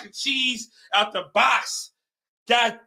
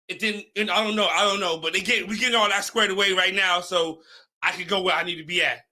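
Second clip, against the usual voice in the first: normal talking voice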